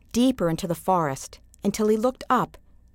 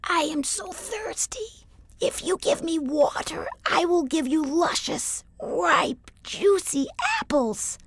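tiny tree tempting with apples; 9 semitones higher than usual. A narrator's reading. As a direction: coax him with a tiny high voice. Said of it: "said the tiny apple tree".

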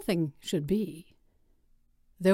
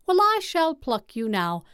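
put-on higher, eager young girl; bright girl voice; 6 semitones higher than usual. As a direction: answer like a willing girl. Girl answering the tree.

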